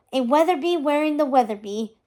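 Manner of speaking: ordinary delivery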